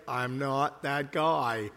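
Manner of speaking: low, drawn-out demurral; reluctant, stretched-out disclaimer; retold reluctance. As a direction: say it low and slow, backing away. Retelling own reluctant reply.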